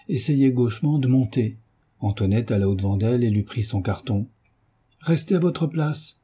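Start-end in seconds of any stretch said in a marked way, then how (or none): 5.0-6.2 high, slow, stern command; curt and dry. A narrator's reading. Surveillante ordering Antoinette to stay seated, curt and dry. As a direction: order it curtly in a raised pitch, drawn out. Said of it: "dit sèchement mademoiselle Pélagie".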